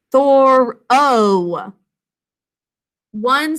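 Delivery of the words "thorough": Thorough drawn out slowly and louder, the ending held; sounding out. Shown as a demonstration. Teacher sounding out Thorough for students, slow and drawn out. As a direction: stretch out Thorough so each sound is heard. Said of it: "what do you hear?".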